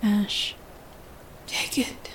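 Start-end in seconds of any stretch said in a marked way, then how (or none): 1.5-2.1 hushed, rasped plea with hardly any voice; splintering rasp. A narrator's reading. Masked man urging you to take it, in a splintering rasp. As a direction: rasp it very softly, almost all breath. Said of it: "he rasps, voice splintering".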